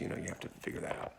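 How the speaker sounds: quick whispered aside; hurried hushed whisper; fast whisper. Real, not acted. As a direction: rush it out under your breath. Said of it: "fast unvoiced aside".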